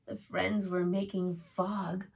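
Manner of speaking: gentle storybook reading voice; reading to children. A narrator's reading. Reads a picture book aloud.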